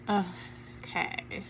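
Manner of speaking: hushed nervous okay; breath it out, barely voiced; whispering. Real, not acted. Nervously checking email.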